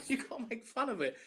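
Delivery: giggling through the line; real laughter on the words; laughing. Real, not acted. Laughing about Liar Liar reference.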